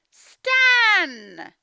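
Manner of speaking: loud high call of Stan's name; mum calling. Acted. Teacher voicing mum, shouting for Stan. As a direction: yell for the boy like a cross mum.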